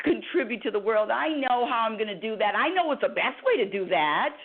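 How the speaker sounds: high whiny pout, rising to a squeal on the first why; childish tantrum of complaints. Acted. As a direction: sulk like a spoiled child demanding her way. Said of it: "I have to do my pouting".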